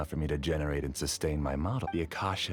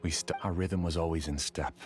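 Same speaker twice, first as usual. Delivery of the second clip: ordinary talk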